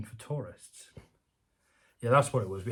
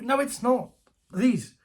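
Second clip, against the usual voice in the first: much higher, louder pleading; exasperated pleading; 12 semitones higher, 8 dB louder, slower than usual. Real, not acted. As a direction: beg them to stop. Speaker's own exasperated rebuttal.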